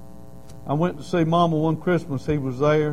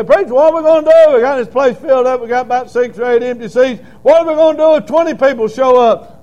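much higher, loud, fast fretting; worried church member; 9 semitones higher, 12 dB louder than usual. Pretend. Church member complaining to the preacher, loud fretting. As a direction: fuss like an anxious member. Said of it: "You say".

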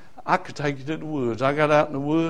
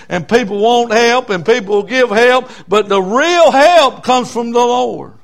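preached scripture; 4 semitones higher, 7 dB louder than usual. Acted.

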